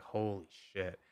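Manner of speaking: a hushed aside as trouble arrives; muttered holy shit. Retold or imitated. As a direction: say it to yourself, stunned. Reacting under his breath.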